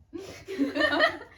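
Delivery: loud embarrassed laugh; burst of laughter. Real, not acted.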